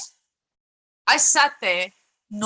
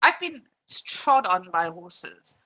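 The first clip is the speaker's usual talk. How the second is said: ordinary talk, nothing marked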